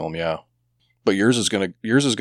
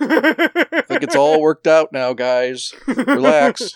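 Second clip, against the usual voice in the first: laughing; 9 semitones higher, 6 dB louder, much slower than usual. Real, not acted. Laughing, mock calming the others.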